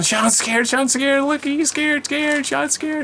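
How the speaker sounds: high, loud sibling taunt; shrill-pitched mocking shout, drawn out; taunting yell. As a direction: yell it like a sister telling on you. Sister taunts him in retold scene.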